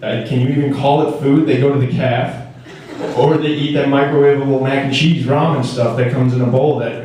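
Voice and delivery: ordinary delivery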